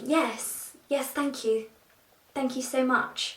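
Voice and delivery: put-on over-polite British caricature accent; mock posh British accent. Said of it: "not all of us talk like that".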